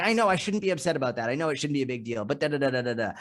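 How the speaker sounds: high-pitched, hurried self-justification; defensive backpedalling. Acted. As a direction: concede it quickly, then plead the case anyway. Acted defensive reply to puzzled friend.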